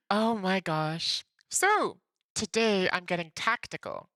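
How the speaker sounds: ordinary delivery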